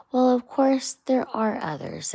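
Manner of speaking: a touch of hesitation in Rat; mild hesitant Rat voice. Acted. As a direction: Rat reluctant to say it. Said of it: "in a hesitantly sort of way".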